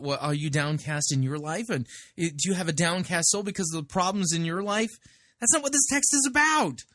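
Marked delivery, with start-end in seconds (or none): 5.4-6.8 exasperated objection. Real, not acted. Objecting to the preacher's reading.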